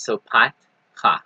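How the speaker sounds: patcha said slowly as two syllables, pat-cha, with the stop after pat; stop after two letters. Shown as a demonstration. Teacher slowly shows students the two-syllable feminine form. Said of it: "you have to stop after two letters".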